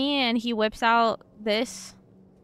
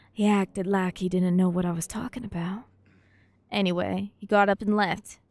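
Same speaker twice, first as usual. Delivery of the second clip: dramatic reading; 5 semitones lower than usual. Acted. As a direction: read it like a creepy diary. Reads game note aloud.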